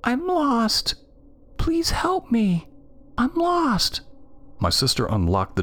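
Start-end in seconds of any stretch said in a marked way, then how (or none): 0.0-4.0 put-on very high, drawn-out child's plea; eerie high whimper; whimpering little girl. A narrator's reading. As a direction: plead like a lost little girl, stretching the words. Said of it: "it whimpered".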